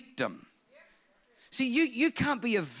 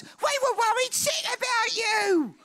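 hammed-up worried mama; fretting mother. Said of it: "I don't know if she spoke like that".

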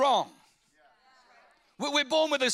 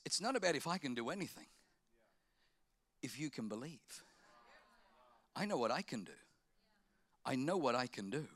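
gentle Jesus; 10 semitones lower, 10 dB quieter than usual. Retold or imitated. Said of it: "But he says".

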